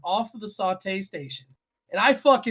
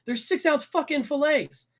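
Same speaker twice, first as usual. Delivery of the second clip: higher, heated kitchen rant; retold angry rant; 6 semitones higher than usual. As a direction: yell at the grill cook, mock furious. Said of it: "I'm like".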